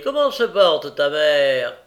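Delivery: « comment se porte ta mère » lancé fort et lentement; provocation moqueuse. A narrator's reading. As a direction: narguer l'orphelin. Noé provoque Olivier. (Noé nargue Olivier, fort et moqueur)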